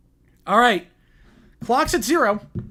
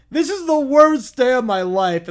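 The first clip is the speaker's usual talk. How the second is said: slow, loud, higher blubbering lament; crying wail; 4 semitones higher, 7 dB louder than usual. Acted. Caillou crying on the wrecked float. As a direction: wail through the tears. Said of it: "Caillou is now crying".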